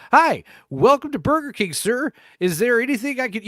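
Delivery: high, loud drive-thru greeting; bright mock Burger King cashier; cheery order-taker. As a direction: chirp the greeting at the customer. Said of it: "it's gonna be a lot of that".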